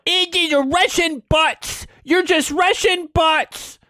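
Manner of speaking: high, slow sneering mimicry; mock them in a whiny high voice, drawing it out; mimicking dismissive establishment fans; mocking quote. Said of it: "are like".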